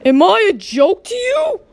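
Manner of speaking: put-on a mother scolding her child, offended; angry parent. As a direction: confront the kid as an offended parent.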